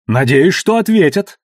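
sharp angry outburst; набросилась гневно. Acted. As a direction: snap it back at him hotly. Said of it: "обрушилась на него Кейт". (гневно, с напором)

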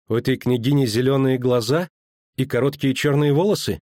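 high, pointed sulky question; sour questioning. A narrator's reading. Girl asking about the princess's eyes and hair, sour. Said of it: "кисло спросила она".

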